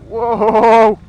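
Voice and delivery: loud sudden whoa; startled whoa. Real, not acted. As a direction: cry out in surprise. Sudden mud on the road.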